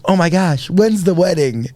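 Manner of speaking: mock excited counselor. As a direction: gush like she's thrilled for you. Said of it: "the lady looks at me and goes".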